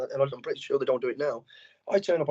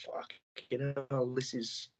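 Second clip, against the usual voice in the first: stunned hush; 2 semitones lower, 9 dB quieter, much slower than usual. Retold or imitated. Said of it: "you're like".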